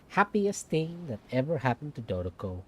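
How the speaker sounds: normal talking voice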